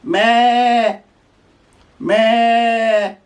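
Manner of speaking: goat bleat. Shown as a demonstration. Goat sound.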